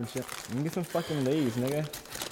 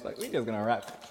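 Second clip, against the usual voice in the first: ordinary talk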